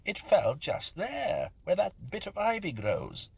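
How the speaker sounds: high, slow, insistent; exclaiming. A narrator's reading. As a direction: point it out, pressing hard. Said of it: "I exclaimed".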